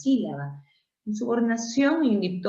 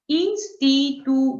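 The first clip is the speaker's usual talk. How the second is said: slow, higher, louder syllable breakdown; 7 semitones higher, 5 dB louder, much slower than usual. Shown as a demonstration. Teacher showing students a syllable division, slowly syllable by syllable. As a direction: split it into slow, separate syllables.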